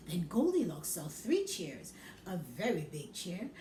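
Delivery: plain reading voice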